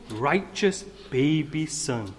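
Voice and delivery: usual speaking voice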